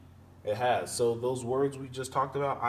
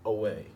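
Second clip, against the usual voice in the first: "away" with a light first syllable and stressed "way"; stress on second syllable; 2 semitones lower than usual. Shown as a demonstration. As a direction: lean on the "way" in "away". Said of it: "uh is the unstressed syllable".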